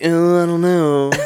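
mock a friend being a hesitant wuss, drawn out and high; teasing impression of a reluctant buddy; Joe voice. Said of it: "That's my Joe voice".

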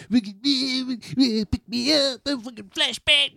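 put-on high, whiny drunk plea; mock drunk friend. Drunk friend begging on the phone, high whiny voice. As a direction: whine it like a drunk begging for a ride.

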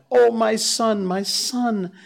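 high anguished calling; mother crying to her son. Acted. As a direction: plead to her lost son. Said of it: "ran across to the herd and cried".

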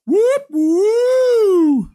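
high-pitched long held "woo"; high, sustained victory whoop; celebratory whoop. Retold or imitated. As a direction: whoop it up like a cheering fan. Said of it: "I'm like".

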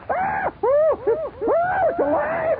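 very high screaming fright; shrieking [name]. Pretend. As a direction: scream as the gift comes alive. [name] shrieks as gift moves.

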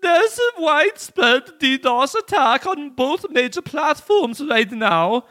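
put-on high, loud German accent. Kim Dot Com answering the hackers in a German accent. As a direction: push a squeaky German accent, slow at the end. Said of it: "I gotta do a, a German accent".

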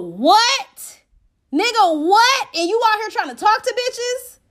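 high, loud incredulous questioning; hammed-up astonishment; outraged disbelief. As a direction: react in mock shock, pitch leaping up. Outraged reaction to thirteen baby mamas.

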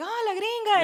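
high playful mock call; high playful mock tease; teasing cousins. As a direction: tease her as the American. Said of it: "And so it's like".

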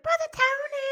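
screaming for the priest. Retold or imitated. Frightened woman screams for the priest, loud high shriek. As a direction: shriek his name in panic. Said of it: "she's like".

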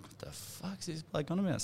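soft, slow, high mutter; puzzled muttered aside; baffled inner mutter. Retold or imitated. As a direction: think it aloud, half under breath.

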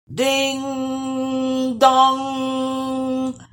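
high sung ding dong like bells; chiming bells. Acted. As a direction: ring it out like church bells. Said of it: "chimed the bells".

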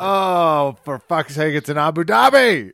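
loud, high burst of frustration; exasperated outburst. Real, not acted. Cohost realizing the fight card clashes, exasperated outburst. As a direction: blurt it out in real dismay.

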